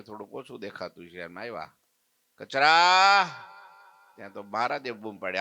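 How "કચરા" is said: calling out. Acted. Old woman calls at the shop.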